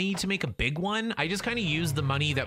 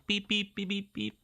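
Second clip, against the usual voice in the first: high-pitched imitated electronic beeps; string of high, short beeps; register beeps; 6 semitones higher than usual. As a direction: imitate a checkout scanner beeping. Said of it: "the sound of the register".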